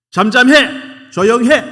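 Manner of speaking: very high, very loud, slow rebuke; shouting to silence. Retold or imitated. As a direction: yell at him to shut up. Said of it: "크게 소리 지르고". (크게 꾸짖는 고함)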